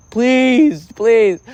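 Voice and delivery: high, loud begging; exasperated pleading. Acted. Begging them to stop talking.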